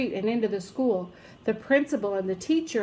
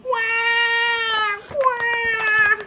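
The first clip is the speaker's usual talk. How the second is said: put-on loud high baby shriek; baby yelling wah; 12 semitones higher, 5 dB louder than usual. A narrator's reading. Baby sees the enormous needle, shrieking. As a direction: scream like a terrified baby. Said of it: "it yelled as loud as it could".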